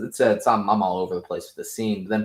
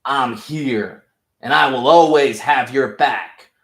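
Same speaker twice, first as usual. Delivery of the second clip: high, loud, slow fatherly superhero voice; hammed-up smug hero reassuring his son; loud put-on hero voice, dragging the words; mock Homelander; 4 semitones higher, 9 dB louder than usual. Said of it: "Homelander shows up and is like".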